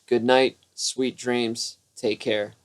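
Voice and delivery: ordinary delivery, nothing marked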